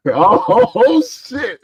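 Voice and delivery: high-pitched, laughing "oh" of disbelief; retold excited scream. Wrestling fan reliving the crutches drop, excited mock scream. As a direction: burst out high, laughing through it. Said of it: "I started screaming".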